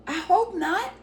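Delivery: high-pitched, retold worried reply; anxious retort. Teller answering the lady's warning of trouble, anxious high retort.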